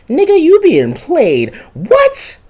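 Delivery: drawn-out line into a squeaked what; mock shocked disbelief. John revealing the player was fooled, shocked squeal. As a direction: leap very high and loud in disbelief. Acted shocked exchange about wife.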